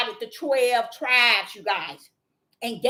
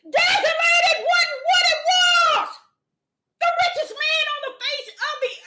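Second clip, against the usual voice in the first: Father going off; 7 dB louder than usual. Retold or imitated. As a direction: shout it out, grieved and furious. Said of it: "the Father going off on the land".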